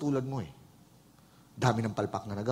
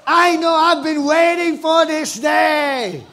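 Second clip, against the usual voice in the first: high, loud, slow hammed-up yell of triumph; mock triumphant shout; 15 dB louder, much slower than usual. Saul bragging to the banquet hall, hammed-up high shout. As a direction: bellow it like a self-crowned hero. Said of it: "if all of a sudden Saul said".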